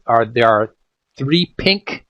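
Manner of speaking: normal talking voice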